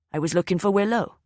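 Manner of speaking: put-on strong Welsh accent. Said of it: "his Welsh accent strong".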